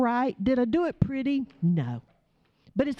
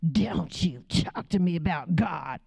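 snarl; 4 semitones lower than usual. Retold or imitated. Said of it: "manifested on me with a snarl".

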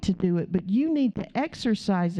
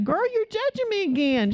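playful teasing; 9 semitones higher than usual. Retold or imitated. Playful teasing reply to a woman.

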